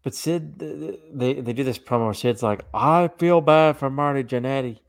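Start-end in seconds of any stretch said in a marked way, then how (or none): 2.7-4.8 a light Arkansas drawl imitating Sid; a hint of Arkansas accent. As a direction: mimic Sid's Arkansas accent, louder. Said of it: "in his Arkansas accent".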